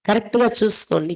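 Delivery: normal talking voice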